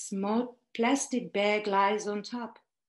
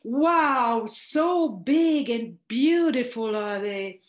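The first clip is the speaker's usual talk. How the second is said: delighted wow, louder and drawn out slowly; retold excited admiration, slow and loud; thrilled; 2 semitones higher, 7 dB louder, slower than usual. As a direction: gush over the vegetables in happy wonder. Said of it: "and I'm thrilled".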